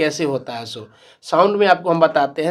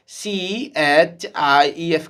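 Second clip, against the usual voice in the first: 1 slow spelling; much slower than usual. Shown as a demonstration. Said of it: "देखिए ई का उच्चारण आ रहा है".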